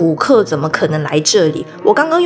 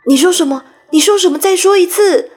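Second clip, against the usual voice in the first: urgent; 7 semitones higher than usual. Acted. Peter urging dying fairy.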